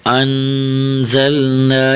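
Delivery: slow انزلنا with the first N held as a hum; nasal ghunna. Shown as a demonstration. Teacher showing ghunna to pupils, انزلنا with a long nasal N. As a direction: hold a humming nasal N in انزلنا, chanted slowly. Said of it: "یہاں تھوڑا سا غنہ کرنا ہے".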